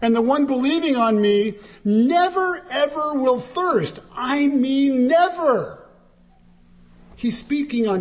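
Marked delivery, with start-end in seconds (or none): 0.0-4.0 "never ever" pushed higher and hard; preacher's emphatic "never ever"; strong double negative. Retold or imitated. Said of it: "it's a double negative, it's very strong". 4.2-5.8 "never" drawn out, slow and high; slow emphatic "never"; stress on never. Real, not acted. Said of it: "it's very strong".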